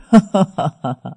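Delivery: loud delighted ha-ha-ha; gleeful peal of laughter; joyful laugh. Acted. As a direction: laugh out your happiness.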